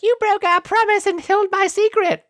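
very high, squeaky fairy voice; mock wronged fairy. Podcaster retelling a fairy's outburst, high mock accusing voice. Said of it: "turns herself human-sized and is like".

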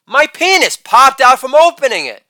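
imitated child's outburst; lightly voiced son. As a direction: act the boy's excited announcement. Son's outburst voiced in a bit.

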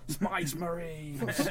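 "Space marines" drawn out very slowly with extra vowels; slow, soft orc "Space marines", vowels widened; orc pronunciation. Retold or imitated. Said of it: "when orcs pronounce space marines".

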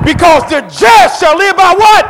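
loud, high, slow shouted question to the crowd; preaching call-out. Acted. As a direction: draw the answer out of the church.

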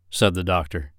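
plain reading voice

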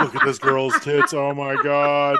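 gawking coworker cracking up; gleeful gawking. Retold or imitated. Voicing employee viewing footage.

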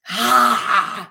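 loud forceful huff with the tongue out; lion's breath exhale to release anger. Shown as a demonstration. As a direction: push the air out hard, tongue out. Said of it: "Like lion's breath".

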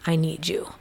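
soft whispered plea; the dog's silent plea, whispered. Retold or imitated. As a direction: breathe it almost without voice. As if he's saying.

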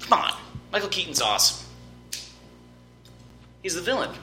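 usual speaking voice

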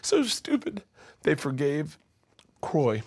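high, tearful voice pushing on; choked up. Real, not acted. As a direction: fight back real tears to finish telling it. Embarrassed by own tears, continues.

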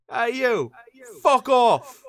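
shouted London yob voice; soccer hooligan. Retold or imitated. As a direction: bellow it like a football thug. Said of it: "soccer hooligan from Millwall".

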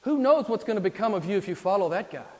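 suddenly forceful devil, urging doubt; Satan warning. Acted. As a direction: raise the voice to scare him off. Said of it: "Can't you hear Satan".

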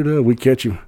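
plain reading voice

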